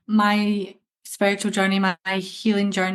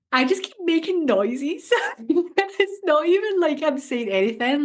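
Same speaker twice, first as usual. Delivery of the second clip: laughing; 7 semitones higher than usual. Real, not acted. Laughing about making noises.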